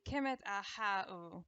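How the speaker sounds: "Kemet a ha u" drawn out slowly; slow, full pronunciation. Shown as a demonstration. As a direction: give each syllable of "Kemet a ha u" its time. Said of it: "supposed to take a few seconds to say".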